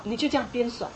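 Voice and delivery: usual speaking voice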